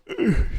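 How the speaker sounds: long real yawn, sliding down; late-night yawn; big yawn. Real, not acted. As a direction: let a tired yawn out. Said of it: "sorry for yawning".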